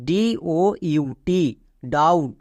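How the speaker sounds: letters of ডিওইউটি drawn out very slowly; slow spelling. Shown as a demonstration. Teacher spelling doubt slowly for students. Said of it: "বি এর উচ্চারণ করব না".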